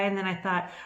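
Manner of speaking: normal talking voice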